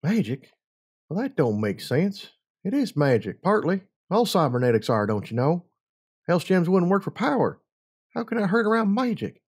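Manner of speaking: a puzzled little filly reasoning it out, pitch rising. A narrator's reading. The little filly explains cybernetics to Hired, puzzled high child voice.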